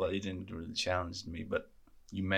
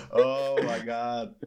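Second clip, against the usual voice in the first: laughing; 3 semitones higher, 7 dB louder, much slower than usual. Real, not acted. Laughing at the bit.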